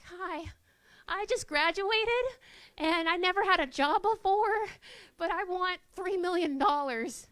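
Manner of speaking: high, loud, overeager pitch; nervous graduate. Pretend. Graduate asking the big boss for millions, high and loud. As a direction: blurt out a huge ask like a nervous new graduate.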